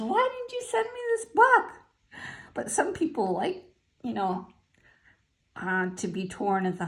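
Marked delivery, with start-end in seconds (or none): 0.0-1.7 imitating upset friend. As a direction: pitch it way up like the indignant friend. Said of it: "She goes".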